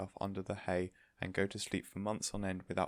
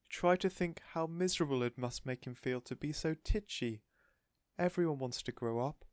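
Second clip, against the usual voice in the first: protesting cry; 6 semitones higher than usual. A narrator's reading. Missus Silver protesting to Mister Hoppy, plaintive. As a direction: plead for the tortoise.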